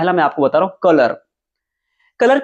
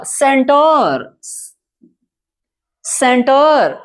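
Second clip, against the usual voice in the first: drawn-out, raised dictation voice; announcing example word; 6 semitones higher, much slower than usual. Shown as a demonstration. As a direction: call the word out high and stretched. Teacher calls out the example word. (ऊँचे सुर में खींचकर बोलते हुए)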